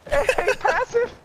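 high, delighted calling; excited calling out. Real, not acted. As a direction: call out with glee.

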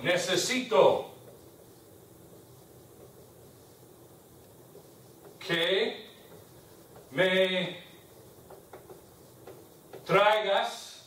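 long pauses between each word; slow dictation. Shown as a demonstration. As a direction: dictate it one word at a time. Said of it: "teacher dictates phrase word by word".

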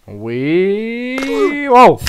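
high, stretched whoop of surprise; surprised whoop. Real, not acted. Gamer startled by the game, high whoop. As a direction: squeal it out, caught off guard.

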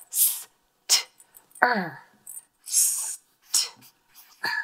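"S-t-er" as separate sounds, s, t, er; sounding out letters. Shown as a demonstration. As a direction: isolate each sound of "S-t-er" with gaps. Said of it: "So let's do this".

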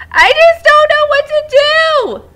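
high, loud, slow despairing cry; panicked wail. Acted. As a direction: throw up your hands in panic. Shell panicking over missing friends.

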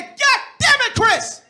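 exasperated yell. Retold or imitated. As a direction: finally lose it at him. Husband finally snaps at Chris.

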